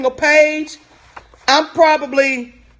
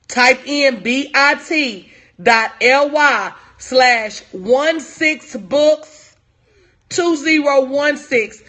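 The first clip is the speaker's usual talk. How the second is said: slow, deliberate, digit by digit for note-takers; slow dictation; slower than usual. Real, not acted. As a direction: spell it out slowly with long pauses. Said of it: "so you can write it down".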